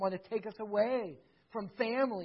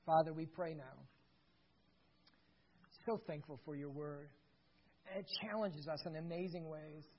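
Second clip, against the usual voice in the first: prayer; 8 dB quieter than usual. Acted. Pastor leading the fathers in soft prayer. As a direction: pray quietly over the fathers.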